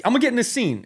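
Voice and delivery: high, eager jump-in line; eager J B Smoove. Retold or imitated. Co-star joining the improv, high and eager. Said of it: "immediately was like".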